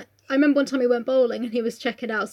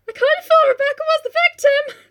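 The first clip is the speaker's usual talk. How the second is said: high, louder dawning voice; mock realisation; 6 dB louder than usual. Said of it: "you're like".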